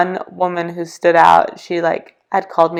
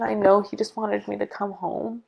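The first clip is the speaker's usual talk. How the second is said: ordinary talk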